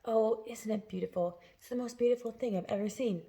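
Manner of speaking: soft, awed murmur; quiet murmur. A narrator's reading. James murmurs to himself in awe, softly. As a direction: breathe it to yourself in wonder. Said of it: "murmur quietly to himself".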